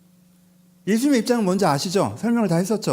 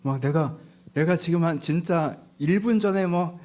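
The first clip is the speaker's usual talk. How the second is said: normal talking voice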